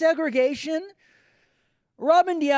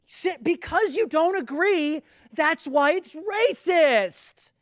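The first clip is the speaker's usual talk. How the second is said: mocking woke accuser; 8 semitones higher than usual. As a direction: ham up the accuser shouting him down.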